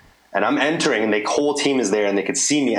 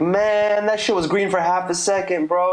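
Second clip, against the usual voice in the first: indignant protest; 9 semitones higher than usual. Retold or imitated. Protesting to the examiner.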